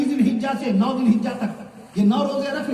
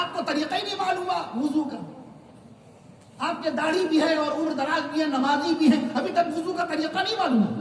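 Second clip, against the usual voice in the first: higher-pitched imitation of a scolding; mock taunting reproach; 6 semitones higher, 3 dB quieter than usual. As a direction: act out chiding someone who should know better. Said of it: "تانا نہیں".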